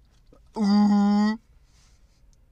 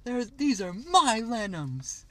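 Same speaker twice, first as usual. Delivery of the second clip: put-on slow, high toddler talk; baby voice; 9 semitones higher, slower than usual. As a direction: lenums like a little kid. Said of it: "Talk like a baby".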